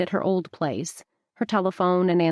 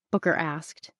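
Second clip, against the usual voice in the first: ordinary delivery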